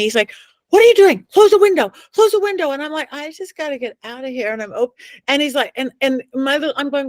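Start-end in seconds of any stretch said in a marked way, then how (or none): 0.7-2.7 urgent high, loud yell; imitated alarmed shouting; Zach. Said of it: "Zach is yelling at me". 3.1-4.5 put-on small, soft little-girl voice; imitated little girl in a dream. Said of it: "I sound like a little girl".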